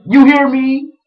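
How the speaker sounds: high, loud calling out; yelling. Retold or imitated. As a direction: imitate a man hollering for attention. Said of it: "he's yelling Ronald's name".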